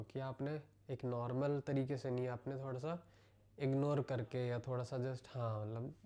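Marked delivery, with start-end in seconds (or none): none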